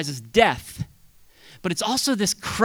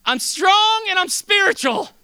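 shouted much higher and louder, slowly; triumphant shout; 8 dB louder, slower than usual. Pretend. Believer leaving church, shouting high and slow in triumph. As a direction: proclaim it like a victory cry. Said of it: "So you're leaving today going".

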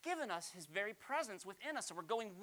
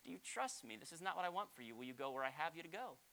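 softer, calm reply; gentle voice of God; 8 dB quieter than usual. Retold or imitated. God gently answering the pastor, softly. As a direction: answer kindly and quietly. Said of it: "He said".

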